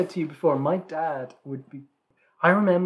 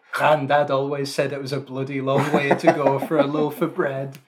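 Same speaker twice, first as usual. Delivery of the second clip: imitating a comic Yorkshire advert voice; parody Yorkshire advert; 5 dB louder than usual. Retelling Ronnie Barker's bread advert parody, mock Yorkshire. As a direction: send up the nostalgic Yorkshire advert, punching it out. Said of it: "did the parody of it".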